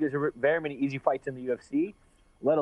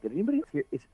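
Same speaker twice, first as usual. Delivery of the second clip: higher, incredulous; baffled fighter asking the gym if he heard that; mock disbelief; 6 semitones higher, faster than usual. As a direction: act baffled by a trash-talk compliment. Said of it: "and I'm like".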